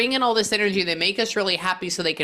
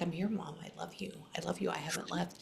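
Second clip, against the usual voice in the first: much softer, hushed and tender; light, gentle reassurance; 15 dB quieter, faster than usual. Retold or imitated. Son from beyond softly reassuring his grieving mom. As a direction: barely breathe it out to comfort her. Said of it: "And he is very light".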